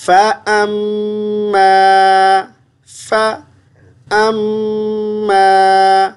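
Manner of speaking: slow drawn-out Quranic chant; tilawat recitation. Shown as a demonstration. Teacher recites word by word for the student, slow tilawat. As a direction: recite each word slowly and melodically. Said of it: "तिलावत वाले अंदाज से भी पढ़ दूँगा".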